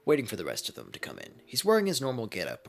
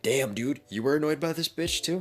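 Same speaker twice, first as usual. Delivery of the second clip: casual Deadpool voice; 10 semitones higher, 7 dB louder than usual. Acted. Talking to Kurama.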